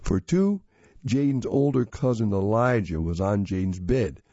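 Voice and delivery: ordinary delivery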